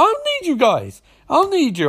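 high, petulant mock protest; acted defiance. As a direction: reject them as a stroppy hero. Barry rejecting his team, acted.

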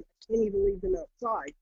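ordinary delivery

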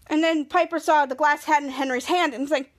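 ordinary talk

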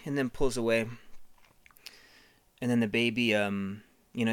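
usual speaking voice

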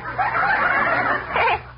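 a high, gleeful giggle. Acted. Jughead laughing at being told to take a bath, high giggle.

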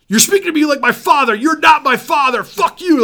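high, loud furious rant; angry yelling. Retold or imitated. Bandmate yelling at Farside for acting like his father. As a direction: yell it like a kid being lectured. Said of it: "Chris starts going off".

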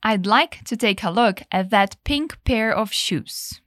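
slow clear model sentence. Shown as a demonstration. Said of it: "Say it again".

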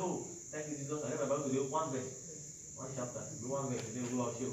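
low, soft perfunctory mumble; mock token devotion. Preacher mimics a half-hearted morning Bible reader, low mock mumble. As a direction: imitate someone getting a quick devotion over with.

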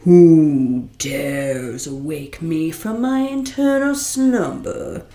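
slow, booming voice from beyond; booming genie voice. Acted. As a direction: boom it out like thunder. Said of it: "His voice boomed as loud as thunder".

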